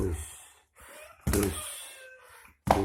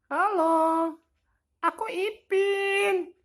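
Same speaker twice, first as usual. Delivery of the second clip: very high squeaky toy voice; squeaky Ipin voice; 4 dB louder than usual. Pretend. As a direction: chirp it like a tiny cartoon kid. Voicing Ipin toy greeting.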